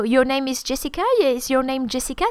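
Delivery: "Jessica" lifted at the end each time; question intonation. Shown as a demonstration. Teacher translating if it's a question, rising intonation. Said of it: "If it's a question".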